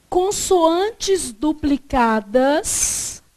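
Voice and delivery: drawn out, heading word by word; slow dictation. Shown as a demonstration. Teacher dictates the rule heading, very slow and drawn out. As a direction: stretch every syllable for students to copy. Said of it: "Você vai escrever assim, ó".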